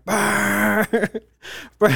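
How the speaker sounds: barge horn noise. Retold or imitated. Telling friends about the barge, mimicking its horn. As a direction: imitate a barge horn going brr brr. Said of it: "it's just like".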